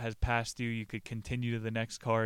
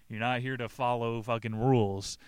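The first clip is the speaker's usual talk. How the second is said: usual speaking voice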